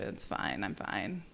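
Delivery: low, soft sulky it's fine; pouting. Retold or imitated. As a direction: mumble it sulkily, clearly not fine. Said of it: "pouting in the grass".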